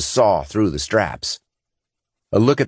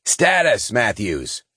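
shouting; 4 semitones higher, slower than usual. Acted. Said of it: "he shouted over the din".

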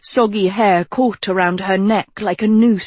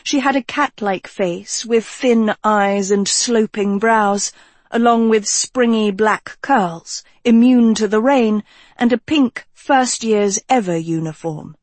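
plain reading voice

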